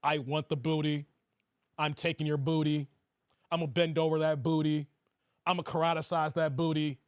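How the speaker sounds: mock leering low voice; deep predatory voice. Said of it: "he looked like he's get-, it's like".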